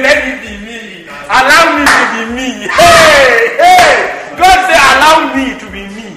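loud preaching cry with ringing heys; fervent divine plea. Acted. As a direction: call it out as God demanding room. Preacher fervently voicing God.

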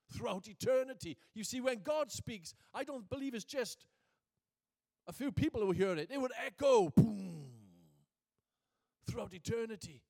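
high, loud pulpit delivery; forceful preaching. Real, not acted. Preacher telling how God's voice echoes through eternity, forceful and loud. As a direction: build to a booming exclamation.

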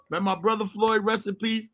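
normal talking voice

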